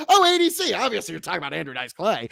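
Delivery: high mock exclamation rushing into a quick obvious answer; squeaky loud "oh", then rattled off fast; sarcastic know-it-all. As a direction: parody someone who instantly gets it. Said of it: "it's like".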